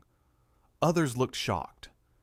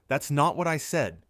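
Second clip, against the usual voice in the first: indignant protest, higher and louder; defensive objection; 5 semitones higher, 5 dB louder than usual. A narrator's reading. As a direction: protest with raised voice. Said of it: "Jeremy protested".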